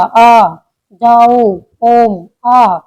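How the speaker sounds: each vowel stretched long; long pluta vowels as in calling or chanting; drawn-out vowels. Shown as a demonstration. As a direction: hold the vowel out past its normal length. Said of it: "कोई sound हमें लंबी खींचनी है".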